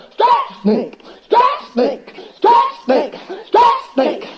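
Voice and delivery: frantic high yells with laughter; the animals near the finish, yelling wildly with laughs; excited yelling. Acted. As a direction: jump up and down and scream it. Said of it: "jumping up and down and yelling".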